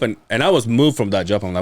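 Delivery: ordinary talk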